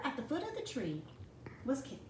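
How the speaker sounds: normal talking voice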